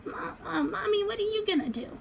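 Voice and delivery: put-on high, delighted child voice; little kid voice. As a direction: play the doll loving her food. Doll asks mom.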